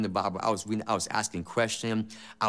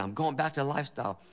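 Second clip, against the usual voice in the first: ordinary talk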